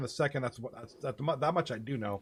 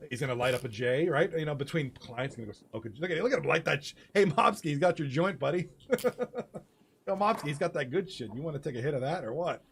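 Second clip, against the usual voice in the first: normal talking voice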